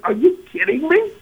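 high, disbelieving outburst; incredulous. Retold or imitated. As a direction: hit it with total disbelief. Incredulous, much higher.

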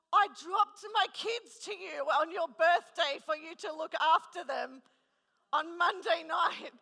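high, loud, slowing cry of disbelief; horrified outcry. Retold or imitated. Telling the friend she dropped kids on her birthday, loud horrified wail. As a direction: wail it out in shocked dismay.

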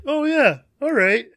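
high, loud sing-song muppet voice; squeaky goofy muppet chef; Swedish Chef voice. Retold or imitated. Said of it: "The Swedish chef from Sesame Street?".

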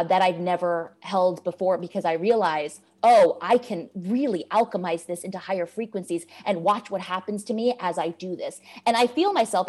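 normal talking voice

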